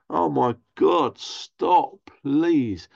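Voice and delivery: drawn-out begging for it to end; exasperated plea. Acted. Onlooker begging pushy parents to stop, exasperated plea.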